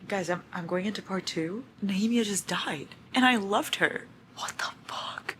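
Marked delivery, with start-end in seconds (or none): 3.1-5.4 hushed disbelief at a loss; stunned whispered grief. Real, not acted. Reader mourning a dead character, whispering. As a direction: whisper it, shaken.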